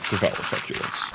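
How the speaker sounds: low, whispered deadpan movie line; flat ominous trailer growl. Retold or imitated. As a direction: intone it like a horror trailer. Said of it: "in that voice".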